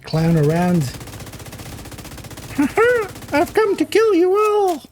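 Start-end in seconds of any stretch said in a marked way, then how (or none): 0.9-3.3 high clown giggle. Acted. Clown voice intro. 3.3-4.8 high, squeaky menacing clown; killer clown voice. Acted.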